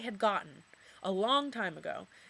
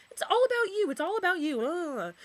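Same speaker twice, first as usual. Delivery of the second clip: high, louder exasperated outburst; 8 semitones higher, 5 dB louder than usual. Real, not acted. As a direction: vent the frustration. Angry rant about the boyfriend.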